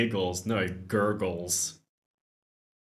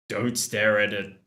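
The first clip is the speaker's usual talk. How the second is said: ordinary talk, nothing marked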